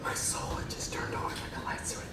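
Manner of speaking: breathy, voiceless whisper of revelation; hushed whisper. Acted. Remembering the moment he finally understood, whispered in awe. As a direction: whisper it as if awed by a sudden truth.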